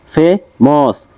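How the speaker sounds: ফেমাস split slowly as ফে, মাস; two separate beats on ফেমাস; syllable by syllable. Shown as a demonstration. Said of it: "এখানে দুইটা দেওয়া আছে".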